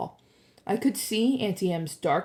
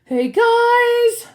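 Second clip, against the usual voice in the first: very high, loud, drawn-out call; long-distance high yell; yelled from above; 10 dB louder than usual. A narrator's reading. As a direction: holler down to friends. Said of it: "Grover yelled".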